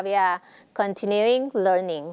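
drawn-out, careful reading; slow example reading. Shown as a demonstration. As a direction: model the sentence for learners. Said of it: "teacher reads example slowly". (อ่านประโยคช้าๆ เป็นตัวอย่าง)

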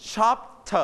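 "shop t" as shop, then a clear "tuh"; teacher's model "shop t", ending in a full "tuh"; separated ending. Shown as a demonstration. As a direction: sound out the t of "shop t" as its own beat. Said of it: "so we pronounce t".

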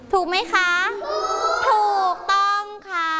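rally the class and celebrate; excited reveal. Real, not acted. Teacher revealing final correct answer. (ร้องเฉลยเสียงสูงอย่างตื่นเต้น)

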